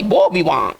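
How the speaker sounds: बो विवा as "bo vee-waa"; बो विवा, open vowel at the end; French pronunciation demo. Shown as a demonstration. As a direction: say बो विवा with silent endings. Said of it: "maximum accuracy तक जो कह सकते हैं".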